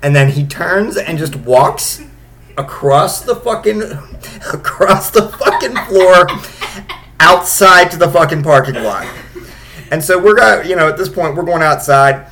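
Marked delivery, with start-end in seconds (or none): 2.6-9.1 laughing. Real, not acted. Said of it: "laughs while telling".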